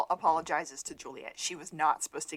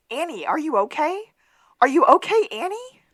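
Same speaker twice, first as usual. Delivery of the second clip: high, loud call, rising on okay; acted concerned call; 9 semitones higher, 11 dB louder than usual. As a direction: call out to check on her, imitating the character. Acts out Sean calling to Annie.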